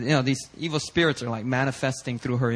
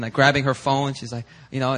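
ordinary delivery, nothing marked

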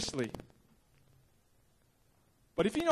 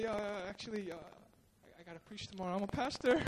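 sheepish confession; 2 semitones lower, 11 dB quieter than usual. Retold or imitated. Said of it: "I was like".

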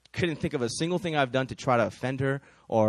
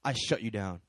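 low, soft, firm command; silent prayer; 7 semitones lower, 6 dB quieter than usual. Acted. Woman praying in her head against a curse, low and firm. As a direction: say it under the voice with quiet authority. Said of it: "she prayed in her head".

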